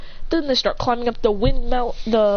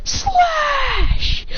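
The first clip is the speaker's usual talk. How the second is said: very high, drawn-out attack shout; stretched anime-style battle cry; 3 dB louder, much slower than usual. Acted. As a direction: scream out your special move slowly. Remy calls out special attack.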